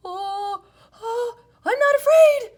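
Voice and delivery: very high, loud shaking shout; terrified shout. Acted. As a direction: shout bravely through fear. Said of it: "he shouted".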